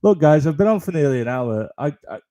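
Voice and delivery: usual speaking voice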